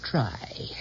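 breathy urging whisper. Acted. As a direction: whisper it like a command. Mona urging Simon.

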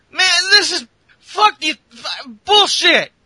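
furious wrestler storming off; fed-up yell. Retold or imitated. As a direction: blow up in disgust. Kevin Owens fed up.

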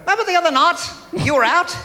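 put-on high, happy New Zealand accent, lilting up; two cheery Kiwis, upbeat whatever the news. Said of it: "still sound happy".

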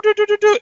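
high beeping tune; game beep imitation. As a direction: mimic the warning beeps. Game warning sound imitated.

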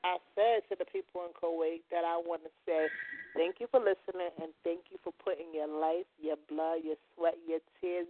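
a quiet tribute to the troops in Kuwait; soft sincere thanks. Real, not acted. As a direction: speak gently to the soldiers far from home.

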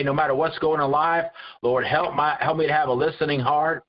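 ordinary talk

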